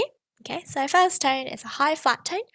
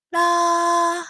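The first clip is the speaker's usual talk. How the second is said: high, louder sustained la; high pitch la; 6 semitones higher, 7 dB louder than usual. Shown as a demonstration. Teacher showing students the high pitch, a high la. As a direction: sing la on a high level note. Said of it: "locate the high pitch".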